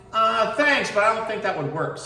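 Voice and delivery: polite refusal. Acted. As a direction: turn the offer down gently. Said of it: "replies little tortoise".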